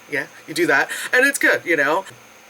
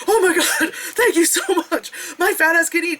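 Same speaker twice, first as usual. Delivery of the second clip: high, laughing thanks; laughing gratitude; 11 semitones higher than usual. Retold or imitated. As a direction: gush with giggly relief. Relieved, grateful at counter.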